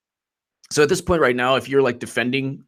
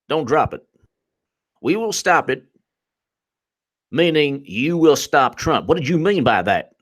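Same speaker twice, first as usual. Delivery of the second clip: high, drawling Southern interrogation; Southern congressman; 5 semitones higher than usual. Acted. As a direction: hammer each phrase in a slow Southern drawl. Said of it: "one of the Southern congressmen's like".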